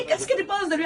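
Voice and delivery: mother fishing for praise of her son; sly mother. Retold or imitated. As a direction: play the crafty mom. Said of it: "Elle a dit".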